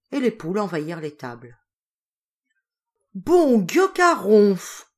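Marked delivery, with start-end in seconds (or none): 3.1-4.9 slow, loud dismayed cry of a peasant; a farmer crying out over his sick cow, loud and slow; exclaiming. A narrator's reading. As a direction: drag it out, loud and appalled. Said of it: "s'écria Pitot".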